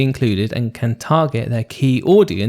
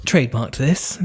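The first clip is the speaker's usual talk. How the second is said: high, probing interviewer; 9 semitones higher than usual. Retold or imitated. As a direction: grill them like a TV interviewer. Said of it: "I'm like that guy on the interviews".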